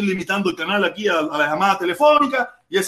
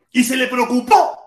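normal talking voice

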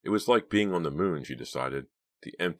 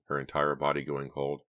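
usual speaking voice

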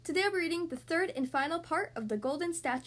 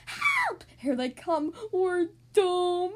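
loud, high cries for help; cartoon doom cry; panicked wailing; 5 semitones higher, 6 dB louder, much slower than usual. A narrator's reading. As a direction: wail in hammed-up terror. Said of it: "mewing at the top of their lungs".

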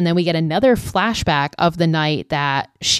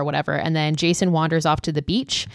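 normal talking voice

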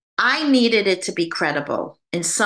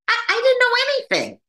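flustered; faster than usual. Real, not acted. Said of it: "own flustered protest".

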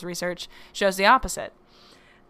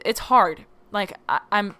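usual speaking voice

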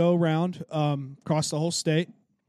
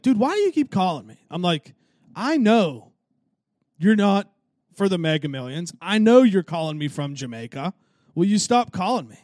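retold exasperated demand, raised voice; fed-up change of tone; 2 semitones higher, 4 dB louder than usual. Telling off a third-time scam caller, exasperated. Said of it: "did I change the mood and tone real quick".

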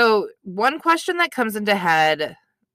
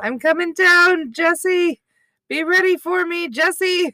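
high mock voice calling down; high-pitched teasing impression; Chrissy; 10 semitones higher, slower than usual. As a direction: imitate her calling out, pitched way up. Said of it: "when Chrissy drops out, she says".

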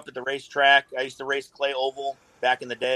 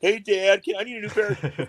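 higher, fast, a hint of a whiny kid; mock kid asking; 7 semitones higher than usual. Kid asks dad for new shoes, lightly mocked and quick.

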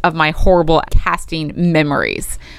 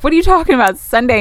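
higher-pitched baffled question; incredulous; 6 semitones higher, 3 dB louder, faster than usual. Retold or imitated. Client to agent calling Sunday morning, incredulous.

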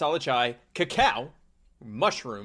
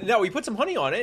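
ordinary talk, nothing marked

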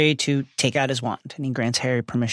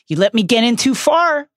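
lightly voiced Snape; 10 semitones higher, 4 dB louder than usual. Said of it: "Snape says".

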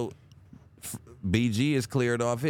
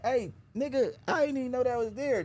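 higher-pitched acted innocence; mock surprised; 8 semitones higher than usual. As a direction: play dumb, voice up high. Acted quote, pitch raised.

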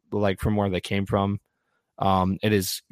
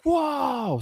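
mock amazed whoa. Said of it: "and then it'll be like".

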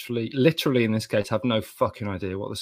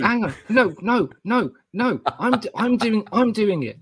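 high, loud flustered protest; laugh-fighting through it; giggly protest; 12 semitones higher, 5 dB louder than usual. Real, not acted. Protesting the silly intro.

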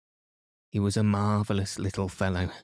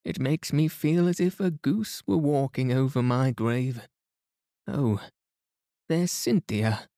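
a higher, fussing matron; voiced older lady; 5 semitones higher than usual. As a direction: play her as a chattering hostess. Mrs Inglethorpe keeps talking.

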